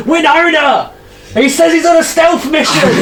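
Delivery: put-on high-pitched old gnome's shout; shouting indoors. Old gnome tells his wife the visitor's secret, shouting. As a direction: blurt the secret at the top of your voice.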